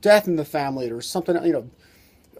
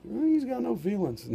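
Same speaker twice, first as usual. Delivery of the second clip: wife complaining; 6 semitones higher, 8 dB quieter than usual. Retold or imitated. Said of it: "And the wives go".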